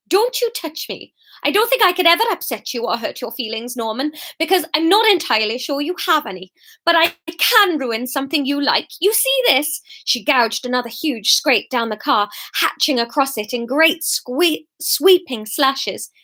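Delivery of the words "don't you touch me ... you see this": put-on Welsh-accented, cutting contempt; furious Fiona. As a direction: threaten the ex with rising spite. Fiona threatens Norman.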